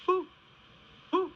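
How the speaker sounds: hoo like an owl; playful owl hoot. Pretend. Imitating owl call.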